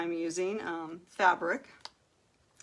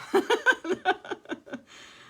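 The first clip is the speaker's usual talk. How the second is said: high, loud, long laugh; flustered happy laugh; 14 semitones higher, 6 dB louder than usual. Real, not acted. Crafter too excited to concentrate, high flustered laughter. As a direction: laugh helplessly at losing your focus.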